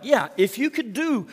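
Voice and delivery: up high, drag out don't need grace. Retold or imitated. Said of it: "Jesus is saying ... is like".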